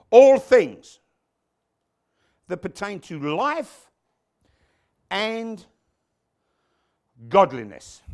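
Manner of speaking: loud, slow proclamation in broken phrases; emphatic scripture reading. Acted. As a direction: declaim each phrase with a pause after it.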